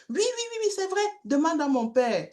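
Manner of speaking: voix d'enfant nettement plus aiguë, qui insiste; petite voix d'enfant qui insiste. Retold or imitated. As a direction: joue le petit garçon sûr de lui, haut perché. Enfant insiste, réponse animée. (voix d'enfant aiguë et insistante)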